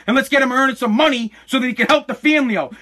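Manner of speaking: usual speaking voice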